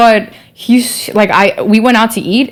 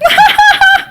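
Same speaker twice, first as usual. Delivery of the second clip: high, short real laugh; quick high-pitched laugh; burst of laughter; 4 dB louder than usual. Real, not acted. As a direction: crack up briefly. Amused at Brooklyn accent remark.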